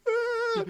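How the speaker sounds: high, thin held mock cry; keen like the hurt kid, put on; imitated crying wail. Kid crying as others huddle round, imitated wail. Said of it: "huddling around this kid".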